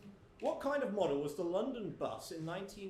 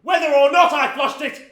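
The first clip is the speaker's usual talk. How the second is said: very loud defiant yell; shouting; 5 semitones higher, 20 dB louder than usual. Acted. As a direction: bellow it out proudly.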